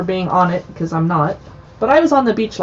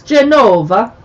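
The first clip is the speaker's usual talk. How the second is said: louder, Italian-accented place name; 2 semitones higher, 6 dB louder, slower than usual. Shown as a demonstration. Host reading chapter names in an Italian accent. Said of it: "my Italian accent".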